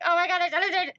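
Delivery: high, loud thrilled outburst; retold excited squeal; excited freak-out. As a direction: freak out over the find. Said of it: "freak out about it".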